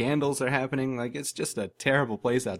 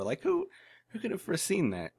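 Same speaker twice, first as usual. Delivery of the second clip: "who" jumps very high, then softer; mock incredulous; 7 semitones higher, 4 dB quieter than usual. Real, not acted. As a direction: squeak the "who" way up in fake disbelief. Sarcastic rhetorical question repeated.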